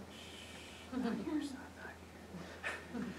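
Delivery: quiet father's shush; hushing. Retold or imitated. Father hushing his son on the Senate floor, whispered.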